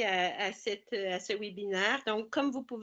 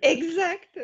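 real laugh breaking through, higher and louder; 7 semitones higher, 7 dB louder than usual. Real, not acted. New mother laughing at the host's accent blunder, laughing. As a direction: blurt it out while laughing.

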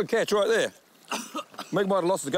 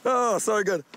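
ordinary talk, nothing marked

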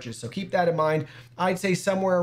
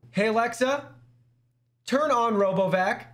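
voice command; 7 semitones higher than usual. Real, not acted. Giving a voice command to Alexa.

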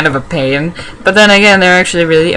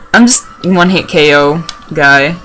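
ordinary delivery

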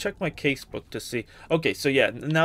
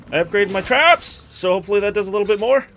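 excited; 4 semitones higher, 5 dB louder, faster than usual. Real, not acted. Excited during fight.

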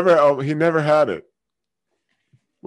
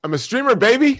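higher and louder, a cocky showman brag; hammed-up boast; 12 semitones higher, 7 dB louder than usual. Streamer bragging to a chat stranger, hammed-up cocky boast. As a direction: sell it like a big-shot streamer.

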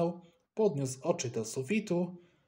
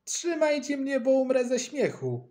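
high, louder mocking scoff; 11 semitones higher, 6 dB louder than usual. Boy scoffs at friend's boast, mocking and loud. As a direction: snort at his boast in disbelief. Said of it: "parsknął Eusebiusz".

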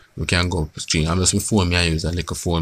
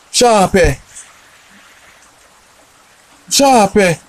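loud high call through the door; shouting for the tenant to answer; 13 semitones higher, 11 dB louder, slower than usual. Acted. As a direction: hail Sharp from outside, pitched high. Calling out at the door.

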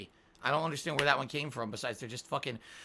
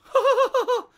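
high squealing laugh; a short bright burst of laughter; real laugh; 9 dB louder than usual. Shown as a demonstration. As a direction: laugh freely, high and loud. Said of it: "here's how I laugh".

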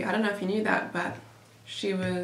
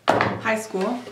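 ordinary talk, nothing marked

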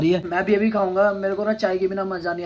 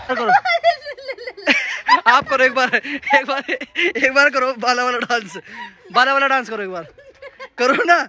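laughing plea; 7 semitones higher, 7 dB louder than usual. Real, not acted. Begging sister, laughing.

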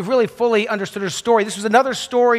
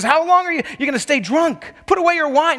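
a sharp, much higher accusing priest; 8 semitones higher than usual. Retold or imitated. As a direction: throw the accusation at her as the priest. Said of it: "he accuses her".

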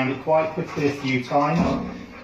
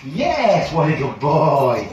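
gushing praise; 5 dB louder, much slower than usual. Real, not acted. Trainer praising the dog for getting the cue, gushing praise. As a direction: drag out the reward for the dog.

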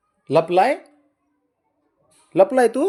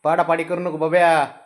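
normal talking voice

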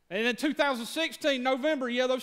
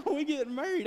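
laughing with excitement, higher; laughing excited; 5 semitones higher than usual. Real, not acted. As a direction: burst out laughing with joy. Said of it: "I was excited".

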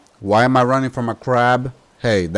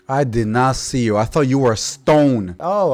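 normal talking voice

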